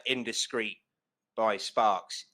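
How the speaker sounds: usual speaking voice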